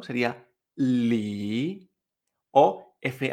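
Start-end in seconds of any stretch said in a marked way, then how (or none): 0.8-1.9 "li" drawn out low, then rising; third tone. Shown as a demonstration. Teacher giving another example, "li" falling then rising. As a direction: dip slowly through "li" and swing up. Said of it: "con tercer tono".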